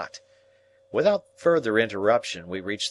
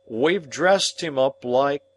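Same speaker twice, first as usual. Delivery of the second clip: nervous; 6 semitones higher, 5 dB louder, slower than usual. Acted. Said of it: "reply in a nervous manner".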